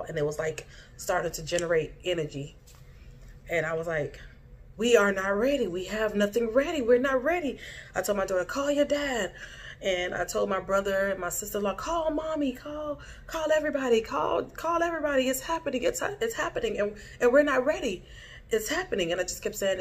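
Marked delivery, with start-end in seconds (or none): 4.8-7.6 retold panic. Panic as ball appears in dream. 8.4-9.3 urgent order. Retold or imitated. Urging daughter in dream panic. 11.8-19.1 high, urgent, pleading call to warn everyone; panicked warning. Retold or imitated. Mother warning her family in a dream, much higher panicked urgency.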